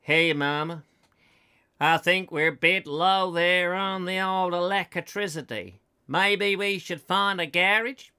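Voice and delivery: slow, drawling rural American; slow mock American drawl, slipping towards Australian; put-on American accent. Americans driving through the Dust Bowl, mock American drawl. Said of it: "They're driving like this".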